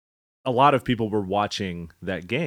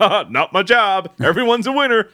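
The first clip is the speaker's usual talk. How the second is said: mock cheer; 9 semitones higher, 6 dB louder than usual. Mocking quote of sports cliché.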